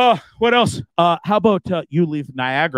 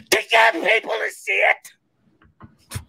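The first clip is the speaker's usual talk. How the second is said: very high, drawn-out disbelief; incredulous squeal; much slower than usual. Acted. Host arguing what trailers are for, high incredulous squeal. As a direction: squeal it slowly in exasperation.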